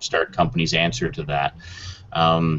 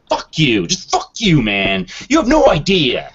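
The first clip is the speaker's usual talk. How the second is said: imitating an angry yell, higher and louder; hammed-up rage at a doubting customer; mock outburst; 9 semitones higher, 5 dB louder than usual. Salesman retelling a rude customer, mock furious yell. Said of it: "I'm like".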